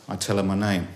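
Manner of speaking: ordinary delivery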